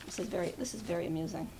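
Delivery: lightly voiced Ellie, understated amusement; imitating Ellie. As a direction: quietly mimic a friend's dry wit. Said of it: "just imagine Ellie saying it".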